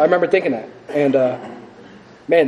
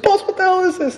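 very high, tearful imitated wail; mock bawling; 3 dB louder than usual. Man calls his sponsor after crying at podium, mock bawling. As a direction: wail it in comic bewilderment.

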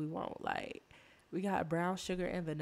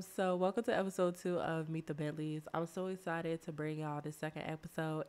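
ordinary talk

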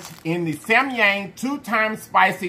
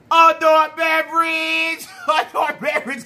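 hype shout; 6 semitones higher, slower than usual. Acted. Announcing the drink.

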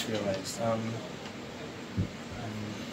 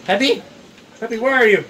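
raised, higher searching call; 7 semitones higher, 4 dB louder than usual. Pretend. Trying to find Peppy, calling out loudly. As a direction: shout to someone out of sight.

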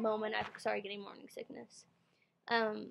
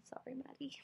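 whispered aside; 3 semitones higher, 13 dB quieter than usual. Real, not acted. Whispered aside to her daughter.

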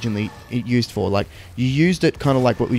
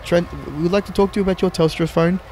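high-voiced Indian telemarketer; hammed-up Indian sales-call accent; 7 semitones higher than usual. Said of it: "I went straight to Indian".

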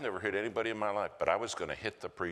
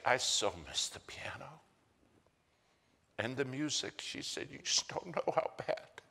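a higher, whispered voice; frail whisper; 6 semitones higher, 4 dB quieter than usual. Retold or imitated. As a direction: let the words of a frail mother come out in a whisper. Frail mom's words, whispered.

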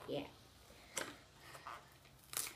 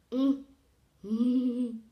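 chewing while humming; muffled through full mouth; 5 semitones higher, 10 dB louder than usual. Real, not acted. Seen 0: Puts candy in mouth at -1; cheeks bulge, chewing during "mm.

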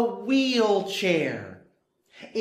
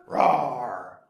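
bear roar; 9 semitones lower than usual. Pretend.

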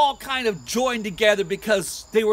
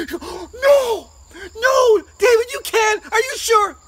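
very high, loud cries of disbelief; hammed-up wifely horror; horrified wife; 12 semitones higher, 9 dB louder, slower than usual. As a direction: react in shrieking shock to terrible news. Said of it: "His wife comes around".

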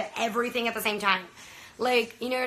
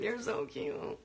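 soft, breathless whisper; whispered cooing; 9 dB quieter, slower than usual. Real, not acted. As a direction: whisper it like a shy secret. Cooing over a viewer.

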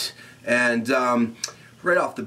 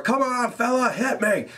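a higher mock taunt asking to be hit; imitating Sheamus; 10 semitones higher than usual. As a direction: goad the other man into hitting you. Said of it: "Sheamus is asking for it".

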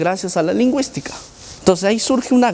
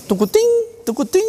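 much higher, quick sing-song imitation of the old Messenger alert; Messenger chime; 14 semitones higher, faster than usual. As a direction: chirp it like the Messenger nudge sound. Recalls Messenger notification sound.

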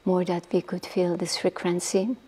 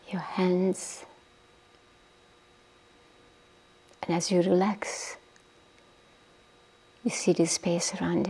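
guided meditation. Acted. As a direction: ease the listeners down into stillness, breathy and unhurried. Leads a guided body-relaxation meditation.

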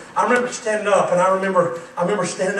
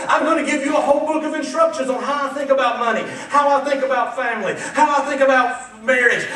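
louder, commanding handing out books; God voiced, preaching; 7 semitones higher, 8 dB louder than usual. God handing each family member their book, commanding. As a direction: voice God as a firm teacher. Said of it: "And he says".

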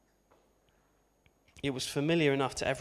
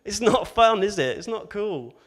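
louder, higher amused protest; laughing protest; 6 semitones higher, 10 dB louder than usual. Real, not acted. Preacher protesting his own joke, louder and higher. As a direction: push back, still half laughing. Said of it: "reacting after the joke".

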